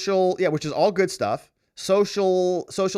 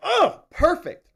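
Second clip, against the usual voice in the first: delighted; 14 semitones higher, 5 dB louder, slower than usual. Real, not acted. Delighted reaction to comment.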